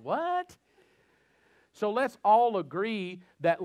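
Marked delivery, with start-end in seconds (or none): 0.0-0.6 a much higher startled yelp; mock surprised. Dad's son teased by his kids, mock surprised. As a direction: play innocent surprise. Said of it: "And I'm like".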